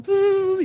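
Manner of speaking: high sustained "bzzz" kettle noise; kettle buzz. Retold or imitated. Mocking people who tea kettle on others, imitated high buzz.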